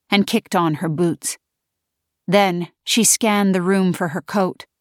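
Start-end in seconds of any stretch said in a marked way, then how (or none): none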